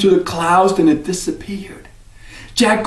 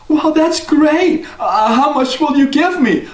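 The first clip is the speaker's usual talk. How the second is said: put-on eager boy; 11 semitones higher than usual. Jack eagerly asks the stranger how much, high. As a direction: ask hopefully.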